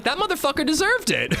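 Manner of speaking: put-on high squeaky little-kid voice, laughing; squeaky child voice breaking into a giggle; toddler voice. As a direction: play the toddler cackling at her win. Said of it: "She was like".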